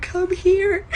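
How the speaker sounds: high, loud mocking imitation; grandpa's mock reenactment. Grandpa mocking her kitchen tour, high and hammed-up. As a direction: ham up her patronizing tone. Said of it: "my grandpa was like".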